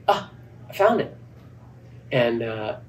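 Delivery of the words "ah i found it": relieved discovery. Retold or imitated. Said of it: "And I'm like".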